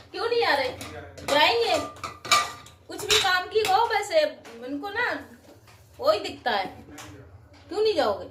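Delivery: high-pitched, exasperated questioning; bickering. Real, not acted. Bickering over the shopping trip.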